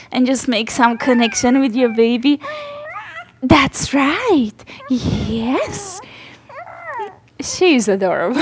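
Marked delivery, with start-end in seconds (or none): none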